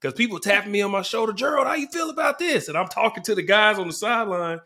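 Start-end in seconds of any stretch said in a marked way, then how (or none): 1.3-2.7 high shouted fan question; fan calling out. Retold or imitated. Fan in the stands shouting a question at him. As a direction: call out to him like an excited fan. Fans tapping his shoulder, calling.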